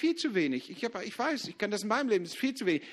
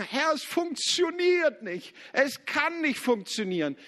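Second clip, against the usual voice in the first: higher, slow complaining prayer; mock complaint; 7 semitones higher, slower than usual. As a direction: act out the doubter moaning to the Lord, higher and slow.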